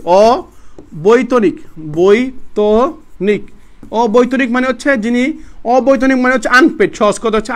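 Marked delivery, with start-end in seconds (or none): 0.0-3.4 very slow 'o... boi-to-nik', parts separated; syllable-by-syllable correction. Shown as a demonstration. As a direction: spell out অবৈতনিক by sound, pausing between syllables. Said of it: "sorry, অবৈতনিক". (খুব ধীরে, থেমে থেমে 'অ, বৈ-ত-নিক')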